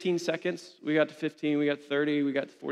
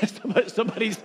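laughing through the words; 5 semitones higher, faster than usual. Real, not acted. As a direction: break into a laugh mid-word. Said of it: "somebody's getting sick back there".

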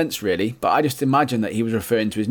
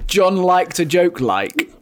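slow, higher mock Geordie; Geordie mimic; 5 semitones higher, 3 dB louder, much slower than usual. Host mocking the widow's words in slow Geordie. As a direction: drawl the quote in mock Geordie. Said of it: "that's so Geordie".

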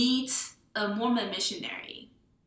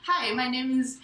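imitated Chinese accent, higher and louder; 4 semitones higher, 5 dB louder than usual. Author demonstrates her heroine introducing herself, mock Chinese accent. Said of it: "she has this really horrible accent".

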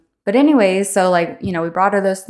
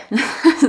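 light laugh; 8 semitones higher, 3 dB louder than usual. Real, not acted. Laughs after reading demo.

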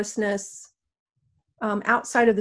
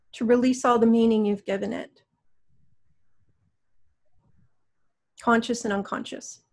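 guided meditation. Acted. Guides a healing session.

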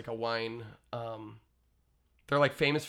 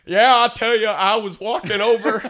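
hammed-up country singer Southern accent; over-the-top Southern accent; 8 semitones higher, 14 dB louder than usual. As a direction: boom it out in a heavy Appalachian-style twang. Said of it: "you have to question these guys' accents".

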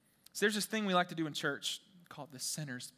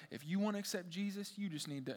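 put-on Southern pastor accent; 8 dB quieter than usual. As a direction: imitate a Southern pastor, a bit badly. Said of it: "he was really Southern".

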